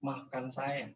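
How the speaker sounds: soft, subdued apology; quietly re-enacted, voice dropped; retold apology. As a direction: recall a meek request for forgiveness. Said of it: "Saya cuma bilang".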